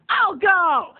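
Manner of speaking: high, drawn-out acted outburst; exasperated giving in. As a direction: give in with a high yell. Giving in to mom loudly.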